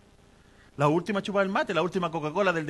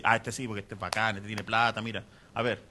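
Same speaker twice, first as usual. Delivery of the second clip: lower, smug voice sizing up a rich giver; mock snobbish God; 8 semitones lower than usual. Preacher mocking the idea of a God who favours the rich, lower smug sneer. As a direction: lower the voice and sneer like you are impressed by money. Mocking a greedy God's view.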